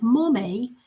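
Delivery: imitated toddler's "mommy" with a hint of British; little British accent. Grandma mimicking how the toddler says mommy, little British accent. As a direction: say "mommy" as "mo-may", slightly British, rising. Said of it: "got a little British accent when he says it".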